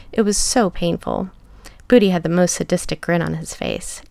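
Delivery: ordinary delivery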